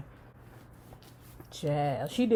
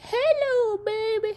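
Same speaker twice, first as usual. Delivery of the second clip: much higher, sing-song granny greeting; sweet granny voice; slower than usual. Retold or imitated. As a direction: coo it like a doting grandma. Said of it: "the grandmother is like".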